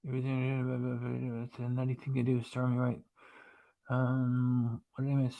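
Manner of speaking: soft, absent-minded reading to oneself; under your breath, half to yourself; muttering to self. Real, not acted. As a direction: mumble quietly while scanning for what you missed. Reading back through chat to herself.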